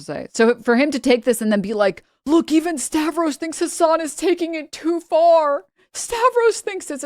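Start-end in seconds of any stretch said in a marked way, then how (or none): 2.3-7.0 mocking commentator.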